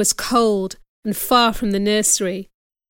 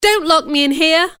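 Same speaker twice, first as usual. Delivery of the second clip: high, loud frightened plea; pleading; 6 semitones higher, 4 dB louder than usual. Acted. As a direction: beg not to be locked in. Jane begging.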